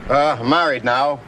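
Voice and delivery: "married" slowed with a rising tune, comic setup; straight man setup. Shown as a demonstration. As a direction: lead the joke like a vaudeville feed line. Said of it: "Okay, now we start".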